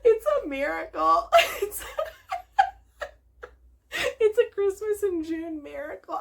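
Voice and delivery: high voice breaking into real laughter; laughing in delight. Real, not acted. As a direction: talk through helpless giggles. Cracking up at her own story.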